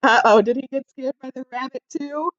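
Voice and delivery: usual speaking voice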